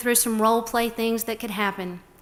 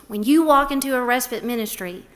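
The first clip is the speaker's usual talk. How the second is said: usual speaking voice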